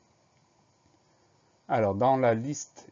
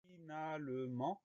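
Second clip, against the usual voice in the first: very soft, drawn-out syllables, raised pitch; slow dictation; 5 semitones higher, 14 dB quieter, slower than usual. Shown as a demonstration. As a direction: model the word syllable by syllable. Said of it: "c'est le mot fi".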